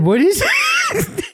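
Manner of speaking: very high, slow shouted question; mock yell. Asking the ghost Jasper what he is, high slow mock yell.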